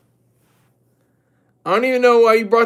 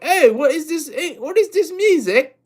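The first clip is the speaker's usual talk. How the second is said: higher-pitched, badly faked English accent; terrible English accent; 6 semitones higher than usual. English people puzzled by music they never heard, bad English accent. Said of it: "That's my terrible English accent".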